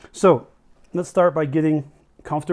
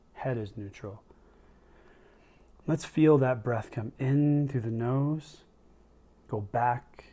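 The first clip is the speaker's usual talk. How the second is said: guided meditation; 2 semitones lower, 5 dB quieter than usual. Real, not acted. Leads a breathing meditation.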